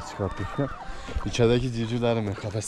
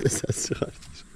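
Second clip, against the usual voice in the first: hushed giggling aside; laughing whisper; 6 dB quieter than usual. Real, not acted.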